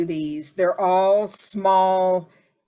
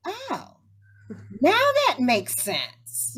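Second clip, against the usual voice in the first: hammed-up lightbulb moment; acted realization; 7 semitones higher, much slower than usual. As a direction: let it click, high and drawn out. Acts out sudden understanding on hearing a line.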